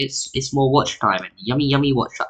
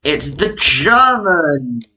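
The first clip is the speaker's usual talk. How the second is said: dramatic announcer; 3 semitones higher, 3 dB louder, much slower than usual. Pretend. Dramatic reveal of new ranking.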